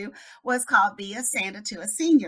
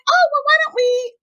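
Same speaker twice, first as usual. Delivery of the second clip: high, chirpy retold invitation; eager suggestion; 6 dB louder than usual. Mom proposing a zoo meetup to another mom, eager. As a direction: pipe up with a bright idea, voice pitched way up.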